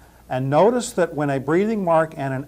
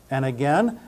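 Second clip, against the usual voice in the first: ordinary talk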